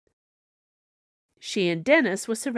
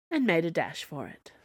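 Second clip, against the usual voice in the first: ordinary delivery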